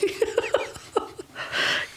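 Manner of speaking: breathy high laugh; real laugh, no voice. Real, not acted. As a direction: burst out laughing. Laughing at sad birthday wish.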